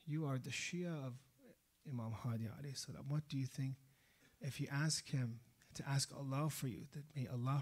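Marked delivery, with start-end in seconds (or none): none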